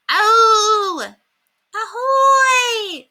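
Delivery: very high, loud screeching squawk; terrified parrot scream. A narrator's reading. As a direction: scream it in bird panic. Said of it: "screamed the parrot in terror".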